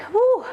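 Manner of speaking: a high, loud woo of relief; quick celebratory woo. Real, not acted. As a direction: let out a happy whoop. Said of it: "relief after hard pose".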